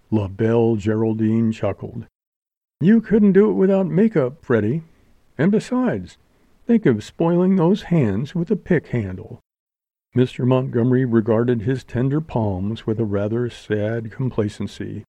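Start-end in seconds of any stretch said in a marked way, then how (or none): 2.8-9.4 playful actress ribbing her partner; lightly voiced teasing showgirl. A narrator's reading. Geraldine voiced higher, teasing.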